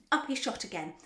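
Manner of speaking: plain reading voice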